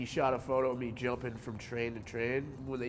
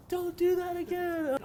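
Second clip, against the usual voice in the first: much higher, louder mock mom warning; worried mom; 5 dB louder than usual. Mom scolding young son after his jump, mock-frantic. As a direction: play his frantic mother scolding the kid. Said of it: "she's like".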